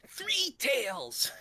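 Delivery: high put-on kid voice; man's fake kid voice. Voice actor playing Tails at a convention, man doing a kid's voice. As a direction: imitate a grown man straining to sound like a kid. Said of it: "a man doing a, a kid's voice".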